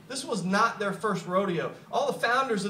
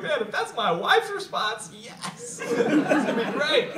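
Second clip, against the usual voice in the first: higher, laughing self-quote; mock glee; 7 semitones higher, slower than usual. Speaker retells his thought about wife's reply, laughing. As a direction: relive the giddy thought, laughing. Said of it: "I was like".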